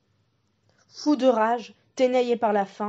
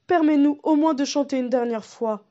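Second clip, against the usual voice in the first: higher, louder pleading piglets; imploring; 5 semitones higher, 5 dB louder than usual. Acted. The three pigs beg the wolf, higher imploring voice. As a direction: beg for one last song. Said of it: "implorent les trois petits cochons".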